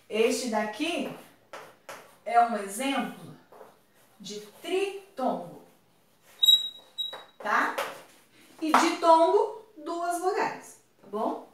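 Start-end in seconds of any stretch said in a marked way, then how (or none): none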